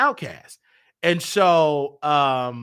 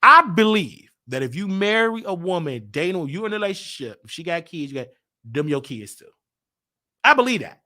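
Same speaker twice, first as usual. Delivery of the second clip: ordinary delivery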